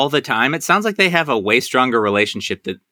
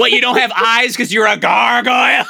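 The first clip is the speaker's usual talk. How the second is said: hammed-up, high-pitched aggressive retort; mock yell with laughter in it; mock shouting; 8 semitones higher, 3 dB louder than usual. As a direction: imitate someone shouting for no reason, half laughing. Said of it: "Why are we all shouting".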